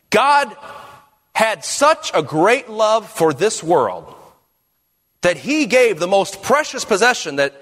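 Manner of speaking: high, slow, emphatic preaching with pauses; preacher's paraphrase. Shown as a demonstration. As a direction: proclaim it, lifting "God" and pausing after it. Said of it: "That's a paraphrase".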